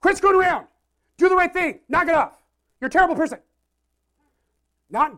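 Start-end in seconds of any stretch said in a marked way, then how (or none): 0.0-3.4 high, loud furious yelling; loud, high-pitched mock rage; angry God. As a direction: yell like a crotchety old man with a stick. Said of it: "mean old man in the sky".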